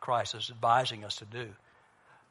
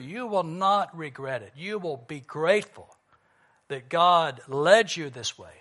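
ordinary delivery

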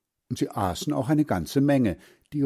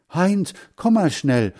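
higher, louder urgent call; calling over; 9 semitones higher, 5 dB louder than usual. A narrator's reading. Hans Frieder to Heinz about the rabbit, calling him over. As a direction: call a friend to come quickly. Boy calls his friend over.

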